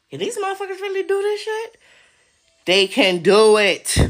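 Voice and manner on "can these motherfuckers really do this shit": higher, stunned disbelief; mock alarmed. Imitating a person realising they're watched, alarmed. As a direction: sell the dawning panic.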